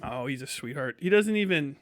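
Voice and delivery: normal talking voice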